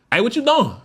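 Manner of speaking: high-pitched questioning call; different tone. Shown as a demonstration. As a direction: pitch jumps way up, then falls. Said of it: "a little bit of different tone".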